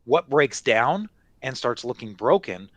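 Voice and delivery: ordinary talk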